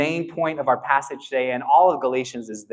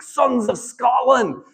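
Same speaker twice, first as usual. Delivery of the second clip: slow, high Scottish battle cry as William Wallace; rally the troops in a Scottish accent; 8 semitones higher, 3 dB louder, much slower than usual. Retold or imitated. William Wallace rousing his Scottish army, Scottish accent. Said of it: "the accent stops there".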